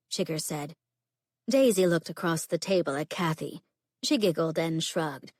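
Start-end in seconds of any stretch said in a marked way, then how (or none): none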